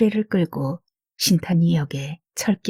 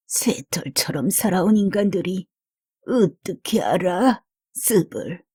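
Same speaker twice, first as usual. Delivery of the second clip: lightly voiced grumbling old woman; foul mouthed granny. A narrator's reading. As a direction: mutter it like a weary old granny. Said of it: "혼자서 중얼거리며".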